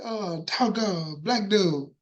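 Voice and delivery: ordinary talk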